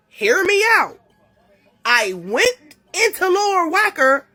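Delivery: can't believe where she ended up; disbelieving excitement. Real, not acted. Retelling the baffling escape.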